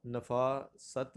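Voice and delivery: نفاست drawn out as "na-faa ... sat"; pronunciation option. Shown as a demonstration. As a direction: say نفاست slowly, a first and "sat" last. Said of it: "تو یہ لفظ کیا ہے".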